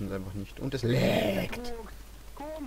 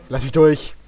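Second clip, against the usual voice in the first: loud call to get through; louder urgent order; loud demand; 8 dB louder than usual. Real, not acted. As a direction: shove past with a shout. Pushing past teammate.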